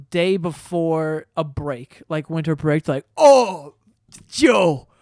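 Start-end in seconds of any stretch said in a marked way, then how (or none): 3.2-4.9 put-on loud, slow drunk bro voice; rowdy party animal. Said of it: "That voice".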